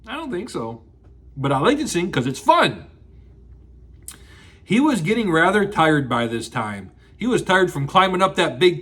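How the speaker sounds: ordinary delivery, nothing marked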